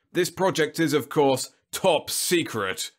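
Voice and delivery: high, loud, solemn pronouncement; reverent. A narrator's reading. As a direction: proclaim it reverently, slowing at the end. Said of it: "He said the words reverently".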